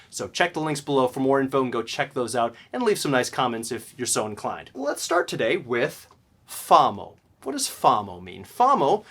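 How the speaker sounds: normal talking voice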